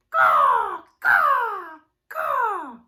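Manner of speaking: loud harsh caws; cawing crow. Shown as a demonstration. Teacher cawing like a crow for kids. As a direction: caw like a crow.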